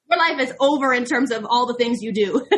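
chuckling through the words; amused laughter mid-sentence; laughing. Real, not acted. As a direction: laugh as you say it. Laughing through a self-quote.

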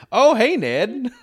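cheery Canadian hello; Canadian accent. Pretend. Canadian officer greeting Ned, laughing Canadian accent. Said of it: "Canadian accent".

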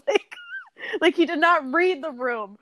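laughing. Real, not acted. Cracking up to her co-host about the clueless ex. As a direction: crack up mid-sentence.

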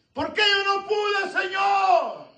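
high, very slow pleading shout; anguished shout. Acted. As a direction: drag out the question in anguish. Preacher voicing the disciple. (grito suplicante, agudo y muy lento)

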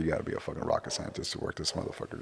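hushed, baffled aside to himself; mock whisper. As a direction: mutter it under your breath in disbelief. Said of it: "I thought".

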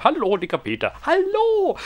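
high squeaky puppet voice greeting; a silly high character voice saying hello; squeaky Peter. Acted.

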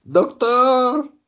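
high, loud panicked cry; panicked shout. Retold or imitated. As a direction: yell for a doctor in alarm. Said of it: "Chopper dice".

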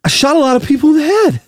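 high, winded movie-star impression; Mark Wahlberg. Host quizzing Travis about a film, breathless Wahlberg voice. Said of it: "when you're doing Mark Wahlberg".

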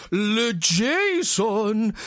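put-on slow, drawn-out French-accented name; French dad voice. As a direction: stretch it like a pompous French father. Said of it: "the dad comes along and goes".

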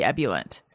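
"ebullient" said EB-yuh-lent; "ebullient" starting on a strong EB; preferred mispronunciation. Shown as a demonstration. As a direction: stress the first syllable of "ebullient". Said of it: "I still think it should be".

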